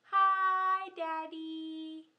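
loud bright "Hi"; cheerful greeting. Pretend. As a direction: call out hello to daddy. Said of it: "Let's say hi to daddy".

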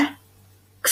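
X as a very soft, voiceless "ks"; airy X sound. Shown as a demonstration. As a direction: let X come out mostly as air. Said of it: "จะออกเสียงเบามาก เน้นออกมาเป็นลมมากกว่า".

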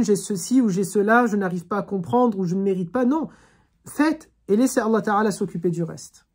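ordinary delivery

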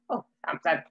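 ordinary delivery

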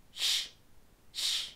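small, soft sh, sh fading away; soft squishy sh. Shown as a demonstration. Teacher showing how small and soft the slender S is. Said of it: "Smaller, it's softer, it's squishier".

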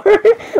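short high-pitched giggle; helpless laugh. Real, not acted. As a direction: let a quick high laugh escape. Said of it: "Why do I keep laughing".

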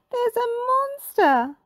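high, slow, loud scared cry; frightened shout. A narrator's reading. Miss Pinchface warning the kids, loud and terrified. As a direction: scream out the alarm. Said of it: "Miss Pinchface shouted".

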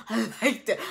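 giggling through it; laughing admission. Real, not acted. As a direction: laugh as you confess it. Admits enjoying the drama.

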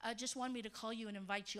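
soft, quick, gentle father's call; gentle dad. Retold or imitated. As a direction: invite her kindly, softly. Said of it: "he's very gentle".

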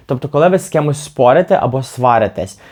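ordinary delivery

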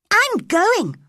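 high, loud angry retort; shouted reply. A narrator's reading. Mary answers the boy's order, shouting back. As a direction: shout right back at him. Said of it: "Mary shouted in reply".

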